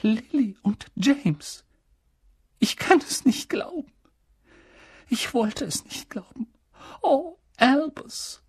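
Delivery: high, breathless, halting disbelief; shaken grief. Acted. Stricken professor confiding to Dumbledore, breathless with grief. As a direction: barely hold back tears. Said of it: "stockte der Atem".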